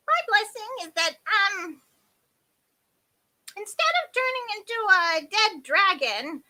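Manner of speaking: high, loud character voice; elderly fairy figure. A narrator's reading. Half-deaf fairy improvising a blessing, high-voiced. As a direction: pitch it up, loud and hesitant.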